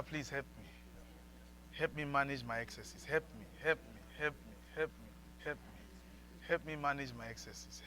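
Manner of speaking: soft, pleading prayer; quiet prayer. Real, not acted. Leads congregation in quiet prayer.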